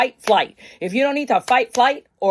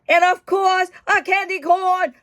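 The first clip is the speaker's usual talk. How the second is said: excited; 10 semitones higher, 4 dB louder than usual. Real, not acted. Showing the card.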